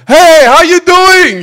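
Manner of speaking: very loud, high booming hello; mock loud-talker greeting. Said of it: "their personality is they're kinda loud".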